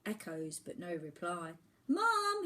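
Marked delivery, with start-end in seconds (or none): 1.9-2.4 high, loud call to the cliffs; shouting louder. Acted. Boy calling louder for his mum, high loud shout. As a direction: call out even harder. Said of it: "he shouted, louder still".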